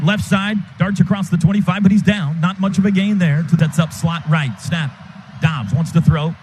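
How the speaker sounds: ordinary talk, nothing marked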